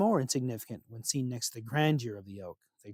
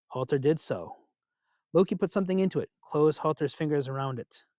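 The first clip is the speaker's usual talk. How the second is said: plain reading voice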